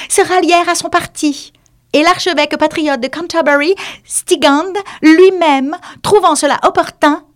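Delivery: high pompous lecturing mouse; resume the lesson grandly. Acted. The mouse resumes dry history, high and pompous.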